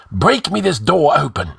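high, sharp command; snapped order. A narrator's reading. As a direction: order the henchman forward sharply. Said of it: "snapped Ascalante".

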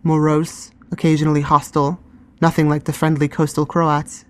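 plain reading voice

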